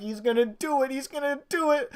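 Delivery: anxious excitement climbing up high; building anticipation. Retold or imitated. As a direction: chant it to yourself on the edge of your seat.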